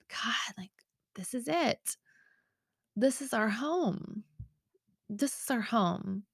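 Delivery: soft, half-whispered realization; hushed awe. Retold or imitated. Wife telling husband they found their home, hushed awe. Retelling emotional moment to husband.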